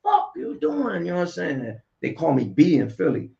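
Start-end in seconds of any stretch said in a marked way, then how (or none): 0.0-1.1 high, slow mock street greeting; a lightly voiced hail from a buddy; quoted greeting. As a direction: voice the guy calling out. Greeting voiced by another person.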